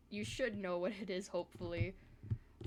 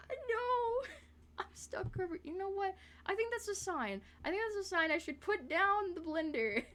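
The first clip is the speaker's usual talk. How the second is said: high, whimpering wail; comic despair; 12 semitones higher than usual. Real, not acted. Streamer giving up on Blender, high whiny despair. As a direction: whine as if close to tears.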